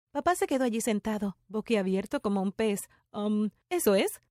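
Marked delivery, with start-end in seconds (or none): none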